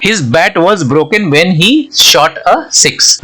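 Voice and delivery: very slow, word by word, like dictation; slow example reading. Shown as a demonstration. Teacher reading the example sentence to students, very slow. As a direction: read it out slowly so students follow.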